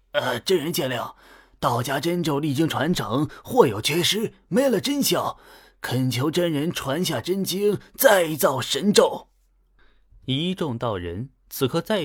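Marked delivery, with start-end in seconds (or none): 0.1-9.3 fervent plea climbing in pitch, louder to the end; agitated pleading. A narrator's reading. Old Taoist begging the master for true scripture, agitated pleading. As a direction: beg with mounting, overwrought fervour. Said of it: "随后浑身激动地打了个摆子".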